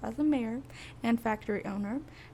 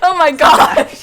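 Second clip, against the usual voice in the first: laughing; 6 semitones higher, 18 dB louder, slower than usual. Real, not acted. Laughing at friend's mistake.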